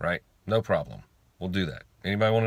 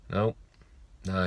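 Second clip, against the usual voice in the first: normal talking voice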